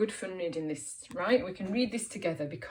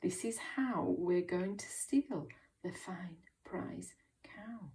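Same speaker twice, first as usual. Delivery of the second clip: hushed conspiratorial whisper; 7 dB quieter than usual. A narrator's reading. Two men plotting to steal a cow, whispering. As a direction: whisper the secret plan, fading at the end. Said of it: "she heard them whisper".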